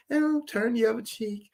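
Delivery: high, slow, put-on mom voice; imitating mom. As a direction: draw it out high, mimicking her. Mom's meek saying, drawn out.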